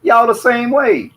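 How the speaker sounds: excited praise. Real, not acted.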